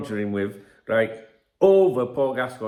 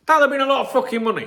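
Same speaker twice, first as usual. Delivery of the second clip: excited outburst; 12 semitones higher, 4 dB louder, faster than usual. Real, not acted. Stressing the huge sum.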